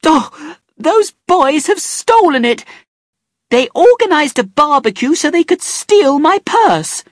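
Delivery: high, loud accusing shout; accusing scream. A narrator's reading. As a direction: hurl the blame at those boys. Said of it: "She started screaming again".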